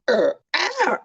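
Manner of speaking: high-pitched play bear yelp; playful "er, AH" with a high second beat; mock bear cry. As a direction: jump up high for a pretend bear noise. Mimics bear after the slogan.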